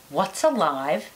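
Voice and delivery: normal talking voice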